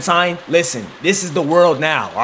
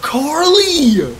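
high, drawn-out happy shout of a name; excited cry; 6 semitones higher, slower than usual. Real, not acted. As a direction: cry out in delight, high and falling away. Excited greeting as Carly shows up.

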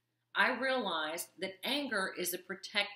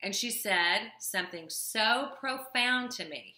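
normal talking voice

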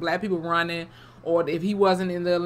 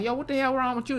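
retold startled question; 7 semitones higher than usual. Acting crew's startled question.

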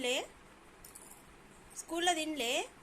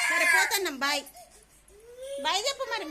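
loud mock goodbye; 4 semitones higher, 6 dB louder than usual. Calling goodbye to sulking daughter.

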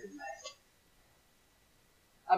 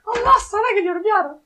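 dramatic wail; 8 dB louder than usual. Real, not acted. Dramatic cry before eating pepper.

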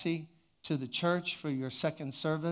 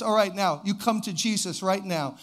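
retold altar call; 3 semitones higher, 5 dB louder than usual. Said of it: "he gets up and he says".

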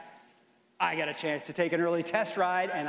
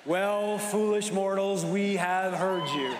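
spooky ghost host; 2 semitones higher, slower than usual. Acted. Haunted Mansion ghost host address.

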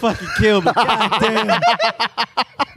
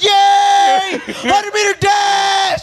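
excited shout; 9 semitones higher, much slower than usual. Retold or imitated. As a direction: yell out the event name. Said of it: "they yell out every event".

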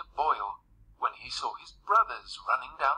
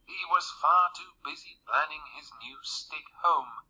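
plain reading voice

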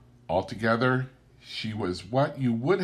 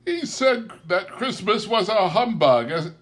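higher, louder amused outcry; crying out; 11 semitones higher, 5 dB louder than usual. A narrator's reading. Nephew crying out to his guests about his uncle. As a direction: cry it out in merry disbelief. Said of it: "cried Scrooge's nephew".

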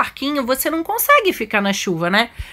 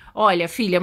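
usual speaking voice

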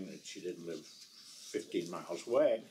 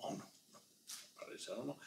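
hushed denial; 4 semitones higher, 14 dB quieter, much faster than usual. Retold or imitated. Lady denies, hushed.

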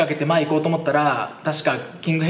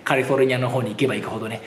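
ordinary talk

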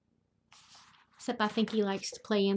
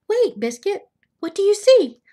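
high, loud call to a puppy; excited calling; 8 semitones higher, 11 dB louder than usual. Acted. Owner asks puppy what he sees, excited call. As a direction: call out with eager surprise.

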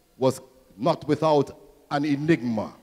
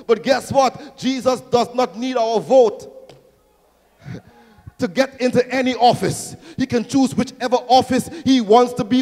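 loud, high preaching that builds to a climax; building pulpit crescendo; full-force preaching; 6 semitones higher, 8 dB louder than usual. Real, not acted. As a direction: preach it in driving parallel phrases, rising in force.

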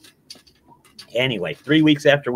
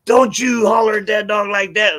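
higher mock shout from the neighbor; retold shout; 8 semitones higher than usual. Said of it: "got me yelling and hollering".

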